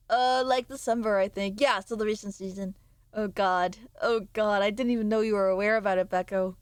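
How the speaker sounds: usual speaking voice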